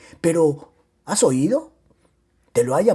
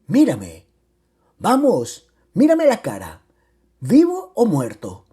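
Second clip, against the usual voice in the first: louder, higher, pressing commands; insistent demand; 4 semitones higher, 5 dB louder than usual. Acted. Husband back from the dead confronts crying wife, insistent. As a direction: demand that she look at you.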